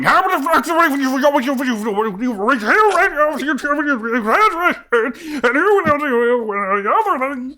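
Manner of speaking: high, loud, fast furious rant; an enraged customer ranting at the secretary; ranting rage. Acted. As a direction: spew it out in a frantic fury. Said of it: "starts rambling, going".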